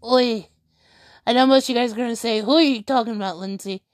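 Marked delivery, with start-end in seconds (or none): none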